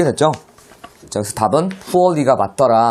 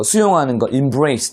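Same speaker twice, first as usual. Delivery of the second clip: normal talking voice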